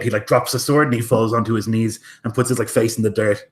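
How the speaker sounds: ordinary talk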